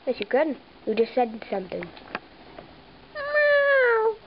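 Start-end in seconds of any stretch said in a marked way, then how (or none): 3.2-4.2 very high squeaky answer; squeaky guinea pig voice. Pretend. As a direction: squeak it like a tiny pet.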